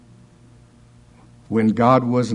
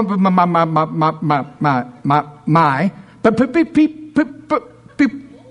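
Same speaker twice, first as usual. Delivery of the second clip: rising, stuck stammer on each word, climbing higher and higher; stuttering Moses; 4 semitones higher than usual. Retold or imitated. Preacher imitating a stuttering Moses before Pharaoh, stammering higher and higher. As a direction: get stuck on every word till it squeaks out. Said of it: "because if Moses would have said it".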